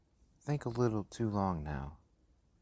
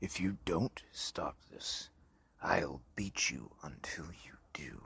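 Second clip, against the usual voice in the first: menacing whisper; 6 semitones lower, 6 dB quieter than usual. Acted. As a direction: hiss the threat so only he hears. Father threatens the boy.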